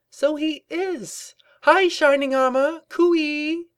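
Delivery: very high, sing-out greeting; delighted calling out. A narrator's reading. Rarity greets Shining Armor across the office, delighted high call. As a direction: hail a colleague with drawn-out delight. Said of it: "She called across the office in delight".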